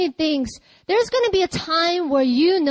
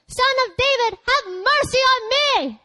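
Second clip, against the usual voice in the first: much higher, slow, desperate plea; crying out all the more; 10 semitones higher than usual. A narrator's reading. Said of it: "But he cried out all the more".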